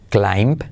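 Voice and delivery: "climb" dicho claim, sin b final; "climb": la i como ai y la b callada; b muda. Shown as a demonstration. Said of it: "pero no se pronuncia la B". ("climb" pronunciado claim, sin b)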